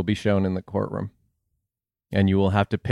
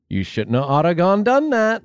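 scolding; 6 semitones higher than usual. Acted. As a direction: scold like a folksy parent. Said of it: "scolding your past self".

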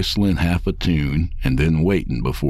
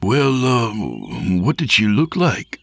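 slightly hesitant, higher-pitched questioning; hesitant father; 6 semitones higher than usual. Acted. Father awkwardly asks his son about her, a touch hesitant. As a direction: lightly voice a father feeling for the question.

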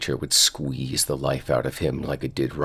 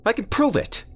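put-on insistent kid voice.

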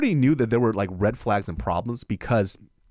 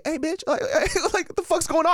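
high-pitched mock panic; retold panicked protest, way up high; freaking out. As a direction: squeal it in comic disbelief. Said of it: "freaking the fuck out".

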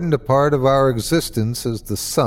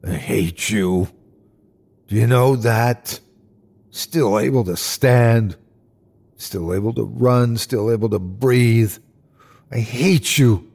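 contained, vitriolic growl; rabid growl. Acted. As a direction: growl each word with pure hatred. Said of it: "each word growled and soaked with vitriol".

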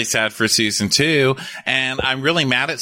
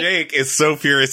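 ordinary talk

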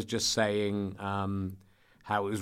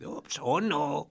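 slow, hammed-up Scottish accent; terrible Scottish accent; 3 semitones higher, much slower than usual. Fan mimicking a children's TV song, terrible Scottish accent. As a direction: put on a bad Scottish accent. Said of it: "I love doing a terrible Scottish accent".

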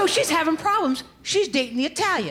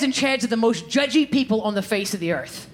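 ordinary talk

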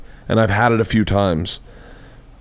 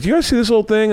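high timid question; 11 semitones higher, much faster than usual. Retold or imitated. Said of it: "And I'd be like".

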